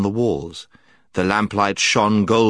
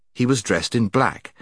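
usual speaking voice

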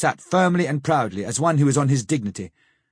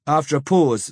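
ordinary delivery

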